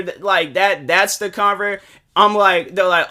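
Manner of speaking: ordinary talk, nothing marked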